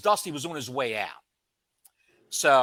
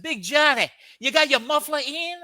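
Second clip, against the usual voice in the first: high, louder imitation of Dusty Rhodes; 9 semitones higher, 4 dB louder than usual. Dusty urging the rookie in the locker room, high and loud. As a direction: ham up the old wrestler's voice. Dusty voiced in wrestling story.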